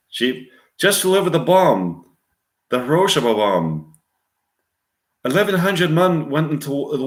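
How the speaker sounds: high-set attempt at an Irish accent; Irish-accented sea-story monologue; Robert Shaw, Irish accent. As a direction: recount it in a cool Irish accent. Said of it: "Robert Shaw in a quiet, cool, monotone Irish accent".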